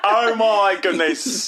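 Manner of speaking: high, loud, drawn-out bad Cockney. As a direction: stretch a fake Cockney exclamation wide.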